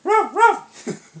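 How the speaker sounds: high, loud ruff ruff; loud dog bark. Shown as a demonstration. Barking for toddler.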